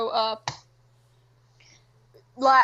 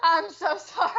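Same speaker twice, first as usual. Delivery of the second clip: higher-pitched, laughing embarrassment; laughing apology; 4 semitones higher, faster than usual. Real, not acted. Caught yelling at cat on air, laughing apology. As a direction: apologize through helpless laughter.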